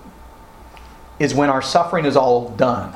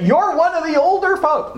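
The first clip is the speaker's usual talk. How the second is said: teasing jab; 12 semitones higher than usual. Real, not acted. Teasing older listeners.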